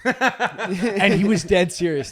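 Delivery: slow, loud laughter through words; laughing hard. Real, not acted. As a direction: crack up retelling. Laughing narration.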